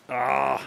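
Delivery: short loud ah of alarm; startled cry. Real, not acted. Gamer cries out at a near miss. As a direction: yelp at the close call.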